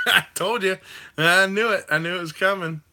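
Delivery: loud and high, gloating I-told-you; triumphant. Real, not acted. Streamer gloating at chat after predicting the complaint, loud and high. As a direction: crow that you called it.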